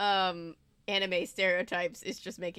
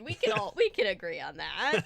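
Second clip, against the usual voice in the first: ordinary talk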